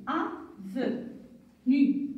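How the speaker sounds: slowly stretched "Avenu", each syllable held. Shown as a demonstration. Teacher models "Avenu" slowly for pupils, stretched syllables. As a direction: draw out "Avenu" as a model for the class. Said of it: "qui peut me lire la première syllabe?".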